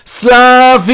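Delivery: long, high, drawn-out yell; hysterical shout. A narrator's reading. Banging on the door for the carer, hysterical shouting. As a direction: scream the name out, holding it. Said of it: "וצועק בהיסטריה".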